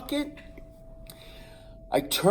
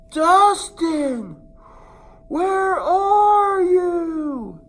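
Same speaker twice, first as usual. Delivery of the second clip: much higher, louder drawn-out cry; desperate call; 14 semitones higher, 8 dB louder, much slower than usual. Retold or imitated. The boy shouts across the yard for his missing cousin. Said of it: "I'm like".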